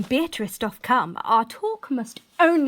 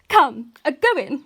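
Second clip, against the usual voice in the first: usual speaking voice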